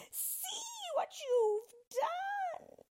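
very high, drawn-out wail; slow shrieking witch; anguished witch's cry. A narrator's reading. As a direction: wail in horror as you melt. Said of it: "she cried".

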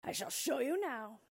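high, boastful ogre voice; proud ogre. A narrator's reading. Ogre offers the cat a demonstration, proud high boast. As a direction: draw out the boast, pitched well up. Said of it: "said the org proudly".

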